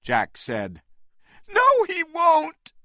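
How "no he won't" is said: very high, loud protest; crying out. A narrator's reading. Anne answering Jack, a very high loud protest. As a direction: cry it back defiantly. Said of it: "she cried".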